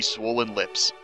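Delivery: ordinary talk, nothing marked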